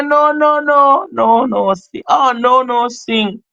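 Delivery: high, loud mock refusal; mock chat voice. Streamer imitating a viewer refusing singing, high and loud. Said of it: "And somebody say".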